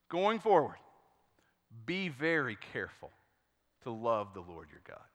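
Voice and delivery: low, soft scripture quote; hushed, weighty plea to the people; solemn farewell charge. Retold or imitated. Joshua's farewell charge, read solemnly.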